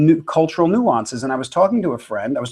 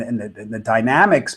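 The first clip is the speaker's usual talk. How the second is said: ordinary delivery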